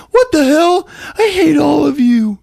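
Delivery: put-on high, loud kid tantrum; hammed-up furious squeal; mock tantrum. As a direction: shriek it as an outraged kid brother. Acting Melvin's tantrum after grounding.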